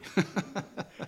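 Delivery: soft breathy laugh. Real, not acted. Laugh after joke.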